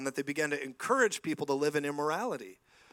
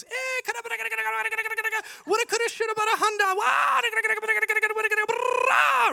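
very high, loud rattling gibberish, building to a shouted roar; mock warring tongues; 8 dB louder, faster than usual. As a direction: parody emergency prayer in tongues. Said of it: "going into warring emergency tongues".

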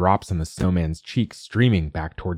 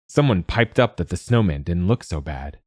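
plain reading voice

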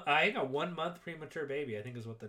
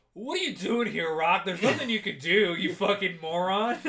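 louder, sneering Paulie imitation; mocking Paulie; 3 semitones higher, 7 dB louder than usual. Paulie jeering at Rocky by the hospital bed, louder sneer. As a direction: jeer at him like a bully. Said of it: "Paulie's just like".

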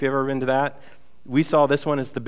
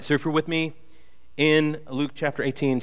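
normal talking voice

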